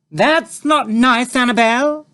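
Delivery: very high, slow, loud breezy mother; lightly scolding; breezily. A narrator's reading. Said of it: "said Mother breezily".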